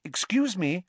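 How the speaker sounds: polite, high, tentative attempt; cautious. A narrator's reading. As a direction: try politely to get attention. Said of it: "approached Professor Lupin cautiously".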